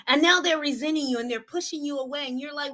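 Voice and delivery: usual speaking voice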